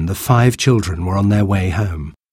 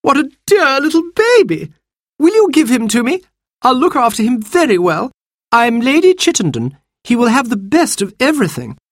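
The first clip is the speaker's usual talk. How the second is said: much higher sweet-talking lady voice; Lady Chittenden cooing at the baby, much higher; grand lady gushing. Acted. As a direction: coo over the baby like a fine lady. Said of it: "she smiled and called out".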